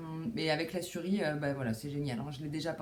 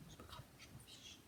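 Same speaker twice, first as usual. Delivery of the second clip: very soft, voiceless whisper; barely audible throwaway whisper; whispered aside; 20 dB quieter than usual. Real, not acted. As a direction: whisper it off to yourself. Muttered aside about watching neighbours.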